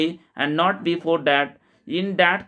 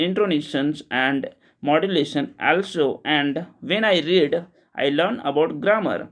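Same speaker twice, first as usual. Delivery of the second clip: slow clear English reading; much slower than usual. Shown as a demonstration. Teacher reading the English version to learners, slow and deliberate. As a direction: read each English word slowly and distinctly, like dictation.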